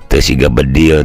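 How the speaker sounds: ordinary delivery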